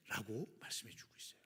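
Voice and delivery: ordinary talk, nothing marked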